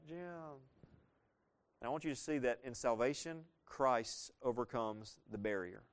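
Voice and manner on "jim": a soft, limp call of a name through a closed door; feeble call. Retold or imitated. Preacher mocking a firefighter who only calls at the door, softly. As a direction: barely call out, as if half-trying. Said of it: "stand outside the door like".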